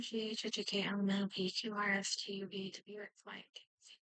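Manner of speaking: ordinary talk, nothing marked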